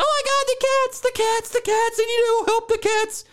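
shrill high mock cat lady. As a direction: squeal it like a frantic cat lady. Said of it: "She's like".

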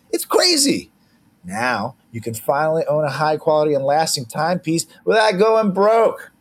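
hyped-up podcast ad read; sponsor ad read. Acted. Host pitching watches to podcast listeners, upbeat ad read. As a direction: sell it like a bro to the listeners.

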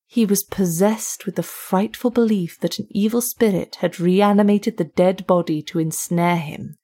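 plain reading voice

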